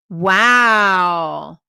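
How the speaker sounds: loud, drawn-out wow; stunned amazement. Real, not acted. Vlogger floored that she never met her attorney, amazed. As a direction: let one astonished word stretch out loudly. Reacting to the surprising claim.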